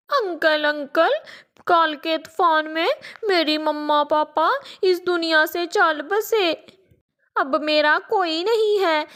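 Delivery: a little crow faking grief for food; pleading child voice, pretend tears; fake crying orphan. Crow child begs parrot.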